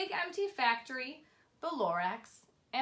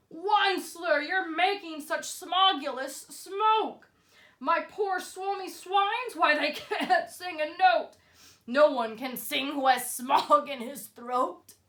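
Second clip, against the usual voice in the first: louder, higher scolding Lorax voice; croaking Lorax; 3 semitones higher, 7 dB louder, slower than usual. A narrator's reading. Lorax scolds the Once-ler about smog, loud croaking voice. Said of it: "he cried with a crufulous croak".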